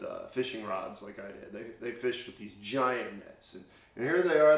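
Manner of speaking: ordinary talk, nothing marked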